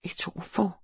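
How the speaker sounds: a guilty, hushed admission. A narrator's reading. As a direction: drop your voice as if someone might overhear. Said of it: "lowering her voice".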